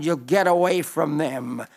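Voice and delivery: usual speaking voice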